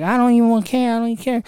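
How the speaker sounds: high, bratty mock defiance; giving a hard time. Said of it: "giving my mom a hard time".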